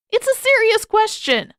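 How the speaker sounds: high, loud mock innocence; mock-serious teasing. Sokka needling Zuko about his bird, high and loud. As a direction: pitch it way up, faking earnest.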